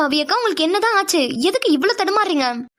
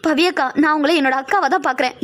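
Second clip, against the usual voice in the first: ordinary talk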